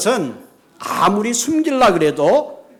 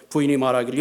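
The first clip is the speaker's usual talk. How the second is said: ordinary delivery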